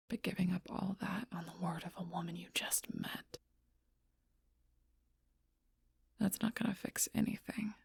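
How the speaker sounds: softly spoken, half-whispered argument; hushed. Acted. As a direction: keep it soft, nearly whispered. Tense confrontation, low voice.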